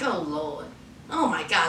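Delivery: low, soft, mortified groan under the breath; embarrassed mutter. Retold or imitated. Daughter mortified seeing her mother work as maid, low soft groan. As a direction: sink down and mumble it in embarrassment.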